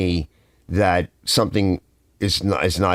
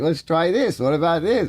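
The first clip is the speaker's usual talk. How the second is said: innocent suggesting; 7 semitones higher than usual. Retold or imitated.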